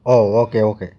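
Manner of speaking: ordinary talk, nothing marked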